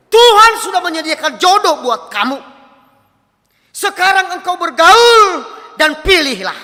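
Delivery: loud, high, drawn-out proclamation; forceful preaching. Retold or imitated. Pastor answering a young person about a soulmate, forceful proclamation. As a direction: proclaim it slowly, at full force.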